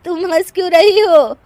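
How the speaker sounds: high, loud wailing reproach; tearful indignation. Acted. Widow to laughing neighbour, tearful indignation. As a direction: protest in hammed-up grief.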